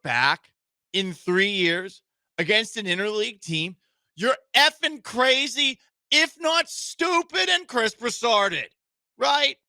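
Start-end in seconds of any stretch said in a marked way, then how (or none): 4.1-9.6 high, slow disbelief; mock-outraged ranting; rising exasperated rant; incredulous rant. Real, not acted. Host ranting about bettor.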